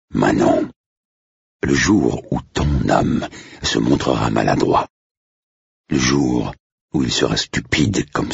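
low, slow and tender advice; a fatherly butler soothing a nervous girl; gentle butler's counsel. Acted.

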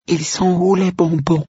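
put-on very high child voice; high-pitched little questioner; little girl asking. A narrator's reading. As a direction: ask like a small girl. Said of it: "demanda l'une des fillettes".

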